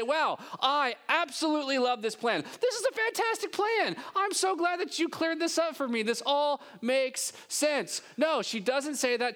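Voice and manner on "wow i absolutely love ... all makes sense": much higher, hammed-up gushing; mock enthusiasm. What Mary does not say to the angel, mock gushing. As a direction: overdo a happy yes to the plan. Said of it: "She also doesn't say".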